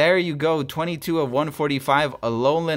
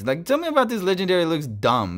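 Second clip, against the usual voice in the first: ordinary talk, nothing marked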